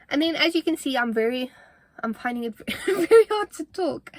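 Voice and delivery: breathless, laughing through words; gasping between words; out of breath. Real, not acted. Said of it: "because I'm out of breath".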